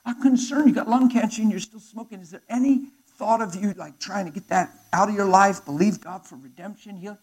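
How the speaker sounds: high, loud worried appeal; urgent concern. Retold or imitated. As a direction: plead with him to quit. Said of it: "I said".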